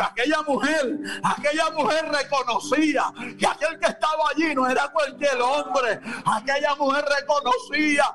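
high, fervent preacher's call; rising pulpit fervor; preaching at full force. Acted. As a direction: proclaim it to the church.

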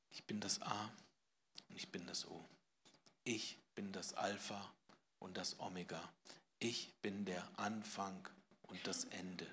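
leise, langsam verklingende Gottesworte; leise feierlich. Acted. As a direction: sprich es andächtig und immer leiser. (leise, ehrfürchtig)

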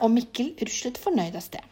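usual speaking voice

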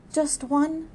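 a touch of pleading, lifted high; coaxing. Acted. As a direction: coax her gently, hoping for a kiss. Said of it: "he coaxed".

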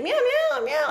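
high squeaky meowing; kitten meow. Retold or imitated. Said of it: "And she's like".

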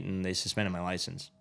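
ordinary delivery

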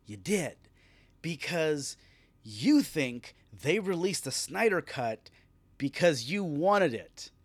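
ordinary talk

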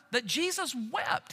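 ordinary talk

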